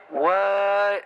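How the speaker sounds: squeaked-up, high disbelief; a startled, high-pitched what?; a high squeak of disbelief at the screen; high surprised what. Real, not acted. Reacting to girl getting gun.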